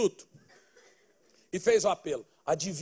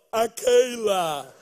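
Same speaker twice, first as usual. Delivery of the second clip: slow, drawn-out name, lightly voiced; girl introducing herself; 7 dB louder, much slower than usual. The girl gives her name to him, lightly voiced and slow. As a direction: say your name sweetly and slowly.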